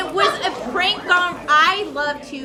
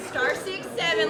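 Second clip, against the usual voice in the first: ordinary talk, nothing marked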